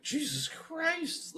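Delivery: high, drawn-out disbelief; retold exasperation. Reacting to a stranger's crisis in public, high slow exasperation. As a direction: stretch the oath in mock shock. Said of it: "it's just like".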